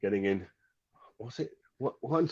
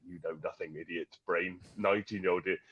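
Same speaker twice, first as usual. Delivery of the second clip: scornful boss; 6 semitones lower, 10 dB quieter than usual. Retold or imitated. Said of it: "he looked at me and he went".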